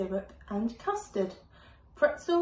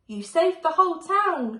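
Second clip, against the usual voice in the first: higher, louder proud praise; 8 semitones higher, 7 dB louder than usual. A narrator's reading. Mum praising Betty after the flood, proud. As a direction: cheer her on. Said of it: "smiled Mum".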